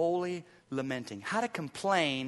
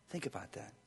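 soft, low hushed voice; hushed pause; 6 semitones lower, 14 dB quieter, faster than usual. Acted. Pastor urging listeners to pause and reflect, hushed. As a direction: let the words settle quietly.

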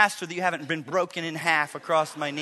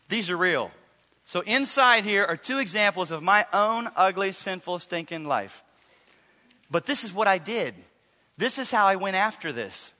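normal talking voice

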